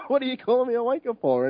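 imitating his Maltese dad through laughter; Maltese accent. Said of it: "with a really strong Maltese accent".

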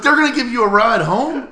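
high, loud incredulous laugh; can't believe it, laughing; hearing the women will drive his friend home, loud laughing disbelief. Real, not acted. Incredulous echo while laughing.

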